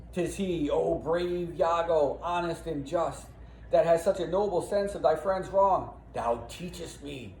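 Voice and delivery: lightly declaimed noble admiration; admiring Othello. Acted. Othello praises Iago, read aloud.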